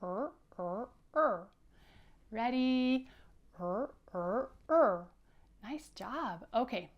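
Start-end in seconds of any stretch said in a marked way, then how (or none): 0.0-1.5 teacher drilling the sound; three R sounds. Shown as a demonstration. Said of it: "make the letter R sound three times like this". 3.6-5.0 "Er, er, er" repeated, last one louder; teacher drilling the sound; three R sounds. Shown as a demonstration. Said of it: "make the letter R sound three times like this".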